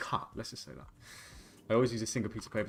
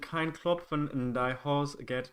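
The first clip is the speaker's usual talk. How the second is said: slow, careful mock German reading; hammed-up German accent, stumbling over the words; German proverb; 6 semitones higher, much slower than usual. Reciting a German saying to chat, slow mock German. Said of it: "We Germans have a saying".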